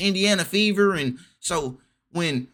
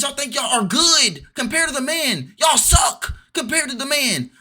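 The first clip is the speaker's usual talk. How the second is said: ranting shout; 8 semitones higher than usual. Real, not acted. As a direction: shout it out, sharp and much higher. Ranting at WNBA players.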